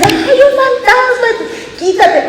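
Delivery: high, slow mock shriek of fright through a laugh; hammed-up terrified squeal; screaming like little girls. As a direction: shriek it like a scared little girl, half laughing. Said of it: "gritando como, así como dicen, como niñitas".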